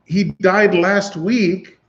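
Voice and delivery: a lightly voiced baffled neighbor; neighbor's bewildered account. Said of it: "he's like".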